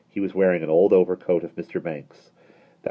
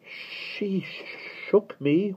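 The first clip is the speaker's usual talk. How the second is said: wailing; 3 dB quieter, much slower than usual. A narrator's reading. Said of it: "wailed Michael".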